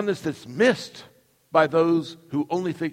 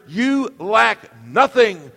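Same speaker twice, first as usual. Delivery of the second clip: slow, loud, higher emphatic preaching; 5 semitones higher, 5 dB louder, much slower than usual. Acted. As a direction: hammer the point home to the congregation. Preaching at full force.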